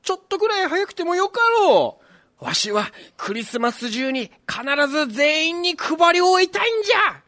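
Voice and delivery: put-on old Santa voice. Santa repents, sets off.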